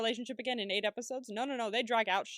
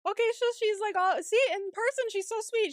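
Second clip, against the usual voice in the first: excited; 10 semitones higher, 4 dB louder than usual. Real, not acted. Excited reaction to Maggie.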